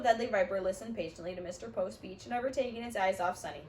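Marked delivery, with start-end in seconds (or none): none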